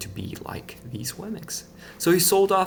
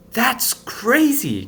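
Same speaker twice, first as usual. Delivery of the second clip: put-on much higher, slow, alarmed girl voice; Lucia crying out; 13 semitones higher, 6 dB louder, much slower than usual. A narrator's reading. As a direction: stretch it out in dismay. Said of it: "cried Lucia".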